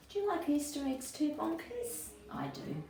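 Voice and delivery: high, soft question to a friend; playful aside. A narrator's reading. Narrator asking Bonkers about Easter eggs. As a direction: chat with the toy listener.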